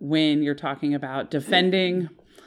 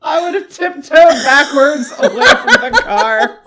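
high, loud, laughing reaction; 12 semitones higher, 12 dB louder than usual. Real, not acted. Cohost reacting to the clown story, laughing loudly. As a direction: gasp it out through laughter.

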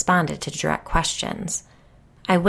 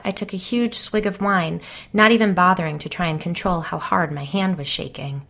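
plain reading voice

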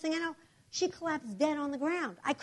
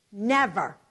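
normal talking voice

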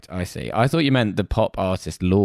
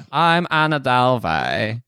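higher, very slow mock German accent; Anna Delvey; 4 semitones higher, slower than usual. Fake heiress introducing herself, Anna Delvey accent. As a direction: introduce yourself in a hammed-up Germanic drawl. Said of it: "That is how she talks".

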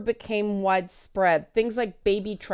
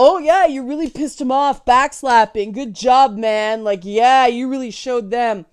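loud, higher hearty congratulations; mock backslapping bro; 3 semitones higher, 7 dB louder than usual. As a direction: cheer him on like a buddy slapping backs. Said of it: "it was all".

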